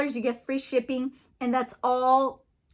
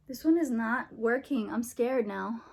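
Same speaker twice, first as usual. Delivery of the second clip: ordinary talk, nothing marked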